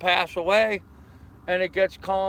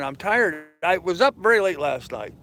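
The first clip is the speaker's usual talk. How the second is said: ordinary talk, nothing marked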